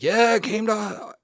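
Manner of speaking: loud, high stand-up impersonation of a boastful politician; hammed-up Trump rally voice; Donald Trump. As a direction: proclaim it big, then marvel at your own statement. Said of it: "Donald Trump impersonation".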